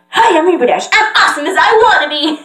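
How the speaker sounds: high, quick, cocky pony; Rainbow Dash. Acted. Rainbow Dash boasting of being awesome, high brash voice.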